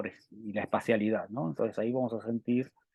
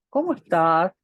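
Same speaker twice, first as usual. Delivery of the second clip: "¿Cómo estás?" ending with a clear upward lift, higher and louder; rising question tune; 6 semitones higher, 5 dB louder than usual. Shown as a demonstration. Teacher demonstrating to students a rising question tune.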